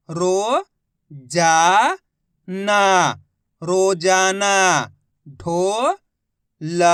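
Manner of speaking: loud, slow sounding out of each syllable; teacher calling out syllables then the whole word; syllable by syllable blending. Shown as a demonstration. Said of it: "अब आगे देखिए".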